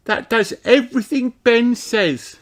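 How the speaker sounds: high bright little princess; fairy princess voice. A narrator's reading. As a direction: explain it like a small fairy. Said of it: "explains Holly".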